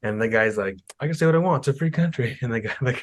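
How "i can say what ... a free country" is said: higher-pitched defiant comeback; mock defiance. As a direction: imitate a heckler standing his ground. Heckler answering guard, retold.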